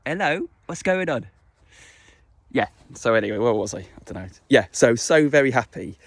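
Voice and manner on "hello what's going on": higher, cooing greeting to a dog; high dog-directed voice. Real, not acted. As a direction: coo at the pet.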